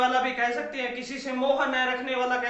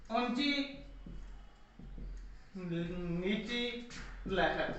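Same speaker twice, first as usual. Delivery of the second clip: ऊंची said softly, then नीची लहर drawn out very slowly, sinking lower and softer; rising and falling tone shown; 6 semitones lower, 11 dB quieter, much slower than usual. Shown as a demonstration. Teacher acting out a rising and falling tone for students, slow and sinking. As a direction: let ऊंची hang quietly, then stretch नीची लहर far down, very slow. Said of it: "स्वर की ऊंची नीची लहर है".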